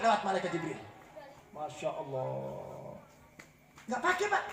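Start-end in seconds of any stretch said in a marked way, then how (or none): 1.5-2.9 drawn-out sigh of dismay. Real, not acted. Preacher's exclamation of dismay.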